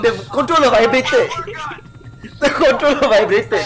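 loud, laughing explanation. Real, not acted. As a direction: laugh it off after a scare. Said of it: "so I panicked".

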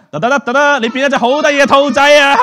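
high, loud showman fanfare; triumphant magic reveal. Acted. Magician to child turned rabbit, triumphant reveal. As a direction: announce the transformation with big mock triumph.

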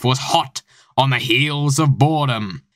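usual speaking voice

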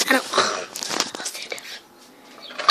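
unvoiced whisper, very slow; whispered aside. Real, not acted. Girl whispering to herself while standing up. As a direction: breathe it to yourself, barely voiced.

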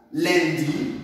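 "Lundi" said clearly for learners to repeat; model pronunciation. Shown as a demonstration. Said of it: "prononcez avec moi". ("Lundi" prononcé clairement, comme modèle)

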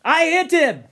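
high, loud protest; indignant. Acted. Ralph insisting he hit the boar, indignant. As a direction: demand the credit hotly. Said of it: "Ralph said indignantly".

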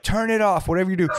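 a high, mock bossy security guard; mock security order. Security guard to DJ, mock bossy and high. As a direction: play the security guy telling the DJ to kill it.

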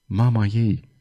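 soft, low murmur; a young man murmurs sadly. A narrator's reading. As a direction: say it under your breath. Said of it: "murmură tânărul".

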